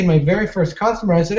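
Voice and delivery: ordinary talk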